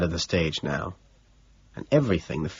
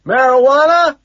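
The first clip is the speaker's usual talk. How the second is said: high, loud yelled question; one stretched shout; shouting back; 9 dB louder than usual. A narrator's reading. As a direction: holler it back over the noise. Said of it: "he shouts back".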